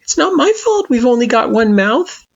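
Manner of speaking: high, playful retort; teasing retort. A narrator's reading. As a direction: retort with a grin. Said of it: "Frisk retorted".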